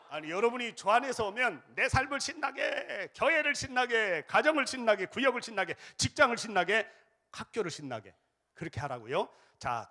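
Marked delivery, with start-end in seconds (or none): none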